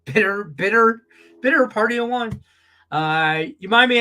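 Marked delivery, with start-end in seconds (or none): 0.0-2.4 self-mocking. Real, not acted. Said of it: "Do I sound like a bad beat".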